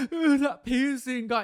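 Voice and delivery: high, slow mock wail; mock weeping. Imagining upset people weeping at home, mock weeping. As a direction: imitate a weepy whine, drawn out. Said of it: "weeping every day going".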